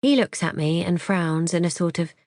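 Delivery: ordinary delivery, nothing marked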